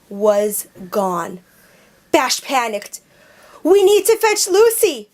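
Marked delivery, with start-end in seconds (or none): 3.6-5.1 high, loud urgent yell; calling the team to fetch Lucy, yelled. A narrator's reading. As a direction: shout it out in a panic. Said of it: "he yelled".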